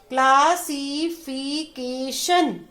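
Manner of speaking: emphatic, chunked sounding-out of "classification"; syllables read separately. Shown as a demonstration. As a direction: draw out क्ला, सि, फि, के, शन in "classification". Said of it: "अलग-अलग कर दिया इसको".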